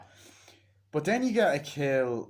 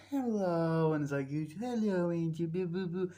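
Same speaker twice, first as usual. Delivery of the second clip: high sing-song cooing; playful high-pitched greeting to a baby; mock baby talk; 6 semitones higher, slower than usual. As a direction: coo as if to an infant, imitated.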